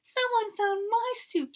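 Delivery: very high, slow, squeaky little voice; high squealy Baby Bear. A narrator's reading. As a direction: squeal like a tiny cub. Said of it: "in her high, squealy voice".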